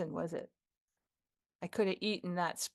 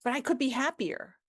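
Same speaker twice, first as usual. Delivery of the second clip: hopeful appeal; 5 semitones higher, 5 dB louder than usual. Acted. First take, appealing to employee.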